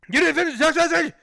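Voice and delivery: high, rapid-fire angry yelling; imitating a caller screaming at him; screaming support guy. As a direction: scream the accusation in one garbled rush. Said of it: "guy from DoorDash screamed at me".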